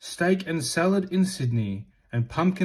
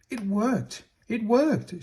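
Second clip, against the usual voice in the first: rising higher on the repeat, thrilled; excited cry; 6 semitones higher than usual. Acted. Hush sees herself whole and cries out happily. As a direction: burst out with joy as it works. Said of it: "she cried".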